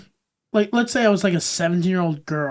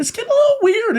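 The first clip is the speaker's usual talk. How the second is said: even higher, silly falsetto; high squeaky voice; 3 dB louder, faster than usual. Pretend. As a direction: squeal the catchphrase. Repeats the joke even higher.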